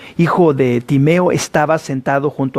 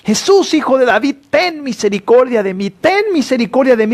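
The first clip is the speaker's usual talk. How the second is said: a high pleading shout, pitched well up; 8 semitones higher than usual. Retold or imitated. Blind Bartimeo shouting to Jesus passing by, pleading shout. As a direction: beg at the top of his voice. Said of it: "empezó a gritar".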